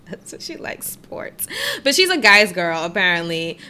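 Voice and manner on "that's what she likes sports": unvoiced whisper; whispered aside. Real, not acted. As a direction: whisper it like a snide secret.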